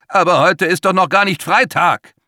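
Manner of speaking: high, loud desperate objection; 1 panicked protest. A narrator's reading. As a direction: plead that it is not Friday yet. Max protests against the monster.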